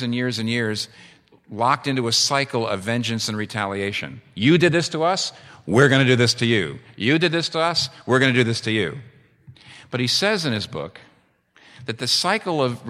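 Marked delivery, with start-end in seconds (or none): 4.3-9.0 raised, higher, heated tit-for-tat chant; mock vengeful taunt. Balkan feuding sides trading threats, heated raised taunt. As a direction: throw the accusation back hard, like feuding neighbours. Feuding groups voice endless retaliation.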